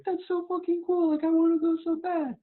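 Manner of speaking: put-on high, loud, wide-eyed kid voice; a kid who can hardly wait to go; excited kid. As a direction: gush like a thrilled little kid. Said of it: "being a kid and seeing it and thinking".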